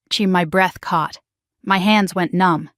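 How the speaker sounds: ordinary delivery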